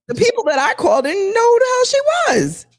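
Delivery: amused disbelief, voice way up; incredulous reveal. Real, not acted. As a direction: deliver the gotcha with glee. Punchline reveal.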